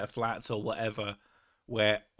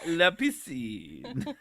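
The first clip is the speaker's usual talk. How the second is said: mock French accent; 3 semitones higher, much slower than usual. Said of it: "Missus Sick with a French accent".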